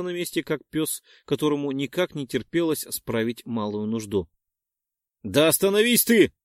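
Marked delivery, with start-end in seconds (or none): 5.2-6.3 higher, louder snapping yell; sharp yell. Acted. Perk yells at spinning Dex, sharp angry shout. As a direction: bark at him to stop. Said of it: "прикрикнул на него Перк".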